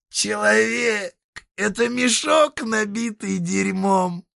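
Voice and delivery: high creaky devil, forced out; squeezed out. Acted. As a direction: squeeze it out slowly. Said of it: "выдавил Дитер".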